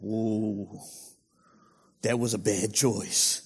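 low, soft, rueful realisation; regretful Adam. Acted. Preacher voicing old Adam's regret, low and soft. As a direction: quietly wince at the memory.

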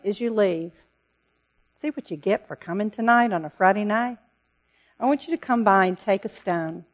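normal talking voice

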